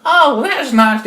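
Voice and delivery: put-on high, loud old-lady coo; absent-minded granny falsetto; fake grandma approval. Wolf humouring the child as grandma, sweetly high.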